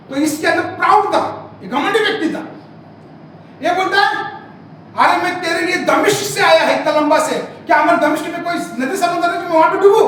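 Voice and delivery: fiery pulpit retelling; forceful preaching. Acted. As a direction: preach the story at full force, voicing each character. Preaches the Naaman story forcefully.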